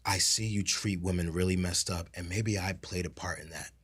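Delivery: quiet, humble confession; a gently acted remorse; soft apology. Imitating a softened apology.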